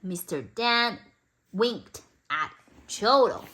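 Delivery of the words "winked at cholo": ordinary delivery, nothing marked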